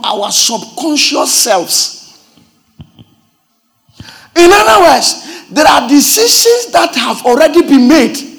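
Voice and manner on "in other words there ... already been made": much higher and louder, cried out in rhythm; impassioned preaching. Acted. Pastor drives the point home to the congregation, shouting sermon. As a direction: lift the church with the message.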